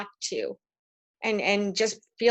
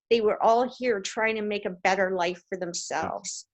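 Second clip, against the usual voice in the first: ordinary talk, nothing marked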